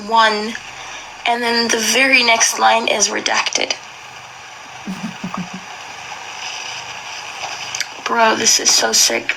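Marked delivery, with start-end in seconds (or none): 4.9-8.0 quiet disbelieving breath of a laugh; soft breathy laugh. Real, not acted. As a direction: huff out a soft laugh at the absurdity. Reading redacted diary in disbelief.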